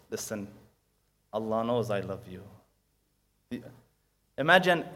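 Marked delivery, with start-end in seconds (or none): 0.1-2.4 tender parent. Acted. Parent says I love you.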